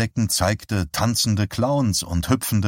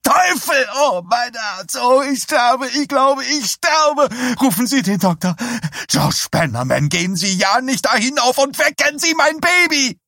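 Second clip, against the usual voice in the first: high, loud hysterical shrieks; hysterical wailing; 4 dB louder than usual. Acted. Woman screaming she is dying, hysterical high wail. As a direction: wail in panic, pitched way up.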